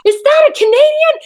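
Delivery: very high, loud imitated astonishment; amazed crowd. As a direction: squeal the question like an excited onlooker.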